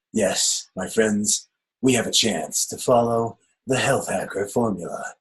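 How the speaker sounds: slow, dramatic trailer announcer; hammed-up movie trailer narrator; movie trailer voiceover. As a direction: boom it out like an epic film trailer. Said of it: "let me get into my voiceover stage".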